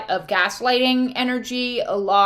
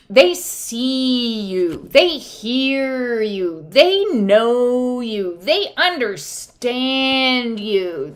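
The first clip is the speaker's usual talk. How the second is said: slow emphasis; much slower than usual. Acted.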